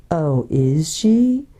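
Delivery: very slow, drawn-out menace; gray, terrible voice. A narrator's reading. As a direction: drag each word out ominously. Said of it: "That gray and terrible voice".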